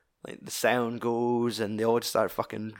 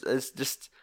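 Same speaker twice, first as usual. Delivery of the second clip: ordinary talk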